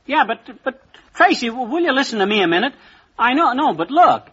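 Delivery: high, flustered, cut-off pleading; senator trying to get the floor. Acted. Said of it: "Sounds like a Republican senator trying to get the floor".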